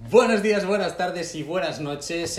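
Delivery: high, loud laughing greeting; sing-song greeting. Acted. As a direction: belt it out while laughing. Intro greeting retaken.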